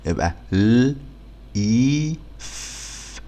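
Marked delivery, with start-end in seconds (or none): none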